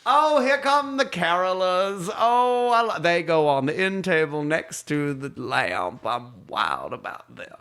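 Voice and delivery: hammed-up grande-dame grandmother; Swoopy announcing. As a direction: herald each thing like a parade, slowly. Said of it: "She'd announce them".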